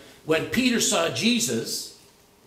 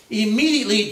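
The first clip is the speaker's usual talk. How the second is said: "Immediately" said alone, higher and louder, then a fall; stressed immediately; 5 semitones higher, 5 dB louder than usual. Shown as a demonstration. Pastor pointing out a key word to the class, stressing it. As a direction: set "Immediately" apart and lean on it. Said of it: "there's the immediately of Jesus".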